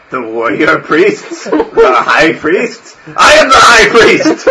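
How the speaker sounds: slow laughing questions, rising to loud and high; an old priest laughing at the idea; chuckling priest. Acted. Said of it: "He starts to chuckle to himself".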